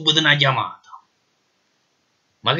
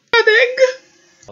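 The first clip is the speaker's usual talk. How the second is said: Neapolitan clown voice; 3 dB louder, much slower than usual. Acted. Detainee answers the marshal.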